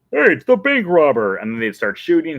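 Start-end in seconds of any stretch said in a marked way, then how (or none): 0.1-1.4 townsfolk shouting. Retold or imitated. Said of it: "they'd be like".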